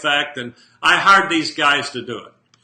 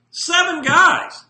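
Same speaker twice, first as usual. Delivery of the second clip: Seven guys much higher and slow, drawn out; amazed repeat; 12 semitones higher, 3 dB louder, much slower than usual. Real, not acted. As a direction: stretch it out in disbelief. Repeating a surprising count.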